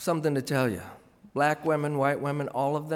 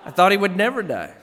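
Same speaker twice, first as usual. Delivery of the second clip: laughing punchline; 6 semitones higher, 9 dB louder than usual. Acted.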